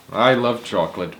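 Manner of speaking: usual speaking voice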